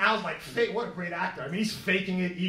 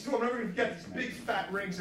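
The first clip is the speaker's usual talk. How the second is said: normal talking voice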